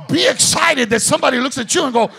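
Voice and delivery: loud, fast preacher's exhortation; preaching at full force. Acted. Preacher to congregation, loud and fast. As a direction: urge them on fast and loud.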